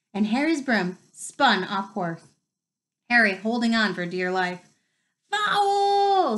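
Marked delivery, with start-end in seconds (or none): 5.3-6.4 a high, loud outraged scream. Acted. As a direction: scream it at the referee. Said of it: "screamed the Gryffindors".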